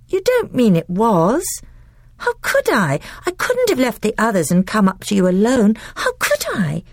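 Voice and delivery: put-on high, quick, defensive little girl; a child excusing herself in a rush; young girl Lucy. A narrator's reading. Voices Lucy.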